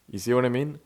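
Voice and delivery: ordinary talk, nothing marked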